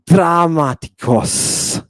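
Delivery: "dramatikos" drawn out slowly, long first "a"; Greek pronunciation. Shown as a demonstration. Teacher reading Greek to students, slow "dramatikos" with long vowel. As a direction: sound out "dramatikos" as "draaah-ma-ti-kos". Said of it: "difference between father and drama".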